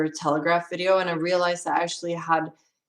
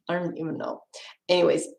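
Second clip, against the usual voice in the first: normal talking voice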